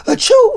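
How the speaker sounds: high, hammed-up fake sneeze. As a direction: sneeze it falsely and loudly. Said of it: "she starts fake sneezing".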